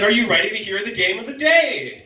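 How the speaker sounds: loud, high announcer hype; hype shout. Retold or imitated. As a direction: pump up the crowd like a game show host. Hyping the class like an announcer.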